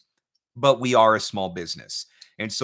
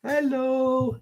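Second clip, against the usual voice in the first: high sing-song hello; 13 semitones higher than usual. Real, not acted. Dad saying hello to his baby, high sing-song.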